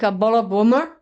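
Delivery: imitating an Irish accent on bomber; a local from Donegal ordering, in Irish accent. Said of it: "and they say, like".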